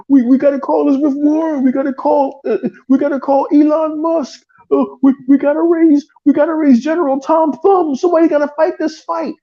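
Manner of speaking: high-pitched, whiny parody of outraged fans; mock panic. Mimicking rival fans upset over Activision deal, mock panic. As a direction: wail it high, as if rallying help in alarm.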